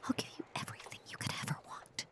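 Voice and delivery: quick, very soft whisper; whispered promise. Acted. Obsessed lover promises the beloved everything, whispered. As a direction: rush out an intimate whispered vow.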